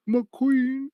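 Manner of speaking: mock-crying, very high little whine; Jon Snow. Host mocking a crying hero's devotion to his queen, high mock whimper. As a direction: whimper it in a high, drawn-out pitch. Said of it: "A crying Jon Snow".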